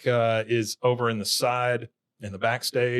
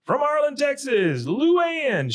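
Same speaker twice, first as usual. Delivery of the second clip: high-pitched hammed-up stage introduction; imitated show announcer; 3 dB louder, slower than usual. Dale introducing Bobby on stage, imitated hype announcer. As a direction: announce a performer to the crowd.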